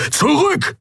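high-pitched bellowed command; roar. A narrator's reading. Keru orders companions back from the cultists, roaring. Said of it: "brüllte er".

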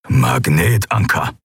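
slow, low growl. Acted. Keru naming the threat, slow low growl. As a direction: grumble the word. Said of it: "knurrte Keru".

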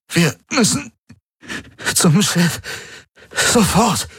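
high, halting, agonised urgency; strained warning broken by pauses; fighting for words. A narrator's reading. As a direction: force each phrase out between gasps. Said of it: "als würde er um jedes Wort kämpfen".

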